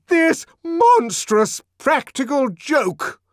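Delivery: high, loud, slow outraged yell; furious shout. Acted. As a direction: bellow it in fury. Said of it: "the director shouted".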